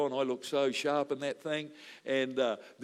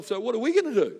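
higher and louder, exasperated; exasperated question; 8 semitones higher, 7 dB louder than usual. Retold or imitated. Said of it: "And so I'm".

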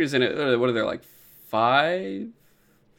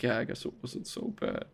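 whispered, much softer admission; shy whisper; 3 semitones lower, 16 dB quieter than usual. Retold or imitated. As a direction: breathe it out bashfully under your breath. Shy character admits she liked it.

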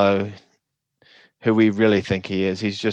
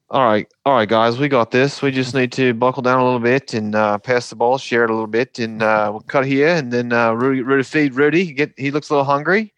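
upbeat Australian coach voice in the huddle, pitched higher; Australian accent; 4 semitones higher than usual. Retold or imitated. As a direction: do a cheerful Aussie Joe Ingles. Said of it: "I need to work on my Australian accent".

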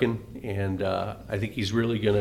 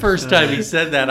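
ordinary delivery

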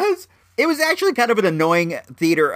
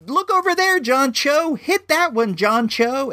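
high-pitched mock moviegoer talking at the screen; chatty moviegoer; 12 semitones higher than usual. Moviegoer heckles the film as if at home, mock high voice. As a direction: imitate someone calling advice up at the actor.

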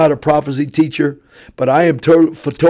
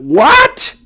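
stunned disbelief; 13 semitones higher, slower than usual. Retold or imitated. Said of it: "I went".

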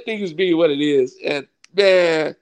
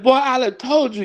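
high-pitched mock reproach; imitated scolding pastor; 8 semitones higher than usual. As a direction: play the pastor saying I-told-you-so. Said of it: "just like he said".

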